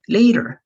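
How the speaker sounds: Later said high and loud with a falling tune; model pronunciation. Shown as a demonstration. Teacher modelling Later for Spanish-speaking students, emphatic. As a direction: show the word Later clearly for the class. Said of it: "tarde o después".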